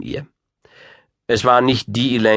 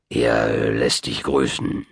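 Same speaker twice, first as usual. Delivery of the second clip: put-on low, slow old man; old wizard; 8 semitones lower, slower than usual. A narrator's reading. Wizard passing the king's greeting, low and slow. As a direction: draw it out low and measured.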